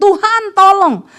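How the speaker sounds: high, loud, drawn-out cry for help; retold shout. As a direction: imitate a desperate call to God. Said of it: "dengar suami saya teriak".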